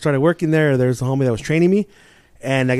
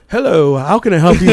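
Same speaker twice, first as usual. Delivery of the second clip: louder, higher put-on answering voice; exaggerated, raised phone hello; imitated phone greeting; 4 semitones higher, 9 dB louder than usual. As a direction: answer as a mock phone character. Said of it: "Did he sound like this?".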